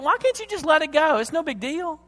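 high, breezy brush-off; mock shrugging it off; breezy dismissal. Voices a dismissive God he rejects.

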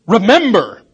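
Remember punched loud as a command; emphatic command. Shown as a demonstration. Pastor stressing the Greek command to his congregation, forceful Remember. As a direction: bark out Remember as an order. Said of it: "he uses the command mood".

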